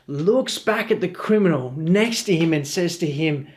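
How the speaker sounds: ordinary delivery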